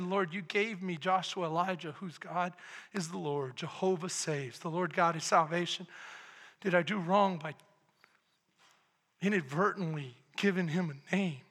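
soft, low, sorrowful prayer; father praying quietly over his son's name; hushed prayer. Retold or imitated. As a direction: confess it quietly to God.

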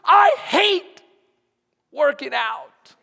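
loud, high mock whine about working out; a loud, hammed-up gripe from the pews; complaining congregant. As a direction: play the groaning churchgoer who hates exercise. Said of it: "Some of us are going".

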